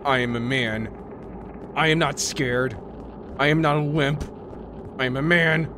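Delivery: ordinary talk, nothing marked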